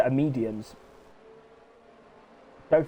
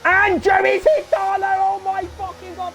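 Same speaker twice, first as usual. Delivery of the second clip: loud, high, slowed crash call; screaming as the cars collide; excited shout; 6 dB louder, slower than usual. Real, not acted. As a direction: yell out the crash in real shock. Crash during live commentary.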